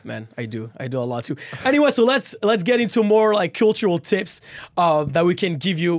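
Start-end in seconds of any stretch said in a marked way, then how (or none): 0.0-1.4 wistful. Real, not acted. Longing for French food.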